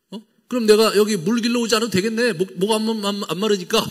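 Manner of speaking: woman scoffing at endless water; sarcastic jibe. Retold or imitated. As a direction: tease him as if the idea is absurd. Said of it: "또 약간 이제 씹어 먹죠".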